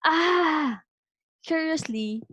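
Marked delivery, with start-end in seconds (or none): 0.1-0.8 high "ah" cry echoed back; echoed scream. A narrator's reading. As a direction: mimic the cry as a mountain echo. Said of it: "heard the same voice from the mountain".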